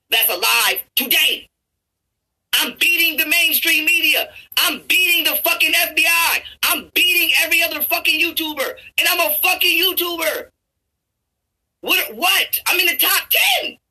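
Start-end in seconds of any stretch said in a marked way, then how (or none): 2.5-13.8 high, insistent shouted brag; fired-up boasting. Real, not acted. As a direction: shout your claim at a raised pitch. Worked-up boast about his own skills.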